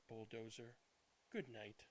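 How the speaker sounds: usual speaking voice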